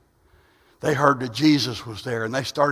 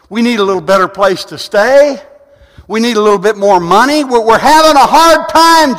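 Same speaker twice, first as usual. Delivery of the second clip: blind men begging; 8 semitones higher, 9 dB louder than usual. Retold or imitated. Preacher imagining blind men begging Jesus, rising to a cry. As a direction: beg for help, building to a desperate plea. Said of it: "They could have said".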